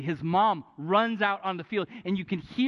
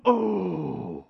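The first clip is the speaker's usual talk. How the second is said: stadium gasp; 3 dB louder than usual. Retold or imitated. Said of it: "you hear this whole stadium go".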